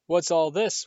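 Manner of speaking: booming, hearty question; loud jovial call. Acted. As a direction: boom it out cheerfully. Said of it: "thundered jovially".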